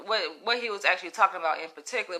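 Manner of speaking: normal talking voice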